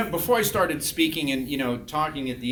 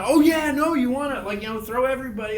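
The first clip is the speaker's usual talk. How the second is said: imitating excited students, high and quick to agree; eager student advice; 8 semitones higher, 3 dB louder, faster than usual. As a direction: chime in like a keen student.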